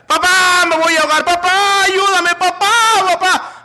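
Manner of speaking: high, loud cries for help; panicked child screaming. Acted. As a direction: scream for Dad like a drowning child. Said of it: "empecé a gritar". (gritos de niño en pánico)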